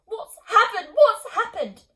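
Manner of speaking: loud, high frantic repeat; frantic cry. A narrator's reading. Distraught mother banging the window, shouting loudly. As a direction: yell it at the window in panic. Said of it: "bangs her wrists against the glass".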